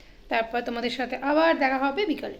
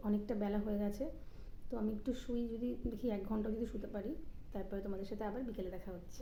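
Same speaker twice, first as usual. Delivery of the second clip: much softer, fading almost to nothing; hushed sign-off; 17 dB quieter than usual. Real, not acted. Mother signing off to viewers before her nap, fading hush. Lying down beside sleeping baby.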